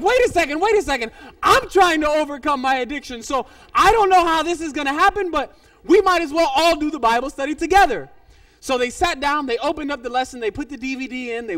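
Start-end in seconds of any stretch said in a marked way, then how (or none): 0.0-8.1 loud retold objection; flustered protest. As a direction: act out her taken-aback reply. She protests, overwhelmed by biker's announcement.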